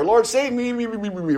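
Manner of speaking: high, frantic repeated me; mock a sinking man's desperate plea; panicked drowning prayer. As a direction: pastor retelling Peter's shortest prayer, mock panic. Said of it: "He would say".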